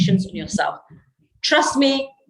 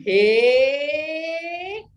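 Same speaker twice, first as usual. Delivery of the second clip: hey pitched higher, rising playfully; 6 semitones higher than usual. Shown as a demonstration. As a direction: toss hey up high and playful. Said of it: "And you can go".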